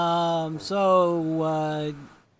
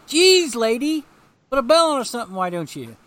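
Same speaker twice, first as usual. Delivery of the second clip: startled yell; 5 semitones higher, 9 dB louder than usual. Acted. Startled by a cemetery jump scare.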